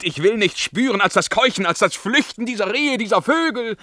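loud, high, fast frantic cry; a man trying to drown her memory in noise; frenzied outburst. Acted. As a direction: build it into a wild, desperate shout.

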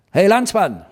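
high-pitched hail to a stranger; calling out. A narrator's reading. Hauke hails a ragged fellow on the dam, calling out. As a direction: call out to stop a passer-by. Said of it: "rief ich".

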